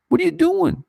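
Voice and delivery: retold outcry, pitched far up; baffled, demanding what they're doing; incredulous. Intern Kramer writing to Penn Studios, high incredulous. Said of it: "to write an email to Penn Studios to say".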